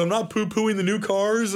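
high, pleading self-defence; imitating an anxious appeaser; mock hand-wringing. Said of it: "hand wringing".

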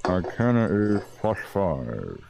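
talking while chewing; words round a bite of food; mouth full. Real, not acted. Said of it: "I'm talking with my mouth full".

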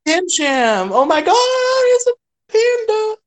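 high, loud delighted squeal; excited squeal. Real, not acted. Fan squealing at a cute panda, high and loud. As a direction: gush over the panda.

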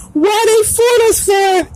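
a mocked damned soul whining; mock crying. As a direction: sneer at them as crybabies. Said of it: "in the lake of fire saying".

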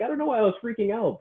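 high, fast imitation of relieved clients; squeaky quick put-on breeziness; gleeful clients. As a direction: rattle it off in a high mock-client voice. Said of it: "and now they're like".

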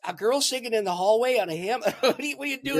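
laughing while talking. Real, not acted. Laughing at his own story.

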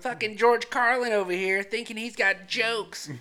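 loud, high fake indignation; mock heckler. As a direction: scoff loudly at the would-be comic.

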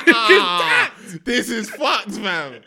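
loud, high-pitched disbelief through laughter; laughing outcry. Real, not acted. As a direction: exclaim it loudly, cracking up. Shocked reaction to the retold plot.

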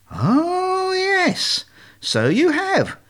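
very high, wondering little voice; delighted naive duckling. Acted. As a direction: discover it with innocent delight.

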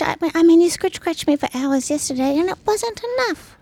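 high-pitched whiny dog voice; dog Jim's voice. Acted. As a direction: speak up high as a pleading pet dog. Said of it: "That's Jim".

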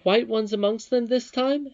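high, loud, puzzled questioning; bewildered. A narrator's reading. Irene questions Curdie about the roses, high and bewildered. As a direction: ask in bewilderment, voice pitched right up. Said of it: "almost as bewildered as he".

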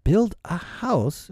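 high, slow disbelieving question; exclaiming. A narrator's reading. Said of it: "exclaimed John".

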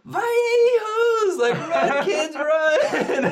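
put-on high, loud laughing old-lady call to run; grandma urging the kids to flee, high and laughing; grandma yelling. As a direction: shout it up high through laughter. Grandma yells while laughing.

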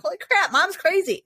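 high mock-child voice; startled kids. Mom voicing her kids' reaction to her yelling, startled. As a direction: imitate kids taken aback by mom. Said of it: "And they're like".

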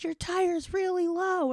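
high, loud, drawn-out mock call; imitated warning call. Stranger warning a driver about the tire, imitated calling out. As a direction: call it across slowly like the passing stranger.

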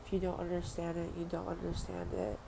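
ordinary delivery

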